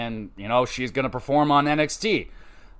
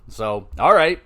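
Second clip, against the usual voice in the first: ordinary delivery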